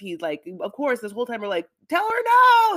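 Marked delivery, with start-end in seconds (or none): 1.9-2.8 high, loud drawn-out shout; urgent yell. Acted. Viewers urging him through screen.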